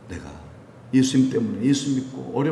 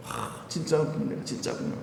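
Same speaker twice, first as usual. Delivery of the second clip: very soft, near-whispered wonder; hushed realisation; 11 dB quieter than usual. Acted. Believer realises they are genuine, hushed to themselves. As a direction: breathe it to yourself as it dawns.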